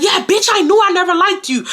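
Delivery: high, heated mock yell; retold yelling. Daughter yelling at the lady, high retold yell. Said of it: "I'm yelling at her".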